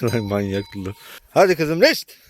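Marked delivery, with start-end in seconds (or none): 1.3-2.2 calling out. Real, not acted. Calling a cow along.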